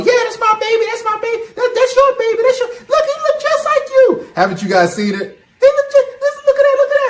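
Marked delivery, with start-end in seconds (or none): 0.0-4.3 mocking a talk show woman. Woman on talk show insisting the baby is his, very high mock voice. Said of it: "women coming on stage talking about". 5.6-7.1 very high, loud squeaky mock woman's voice; mocking a talk show woman. Woman on talk show insisting the baby is his, very high mock voice. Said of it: "women coming on stage talking about".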